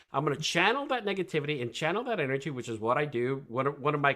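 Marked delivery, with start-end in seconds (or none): none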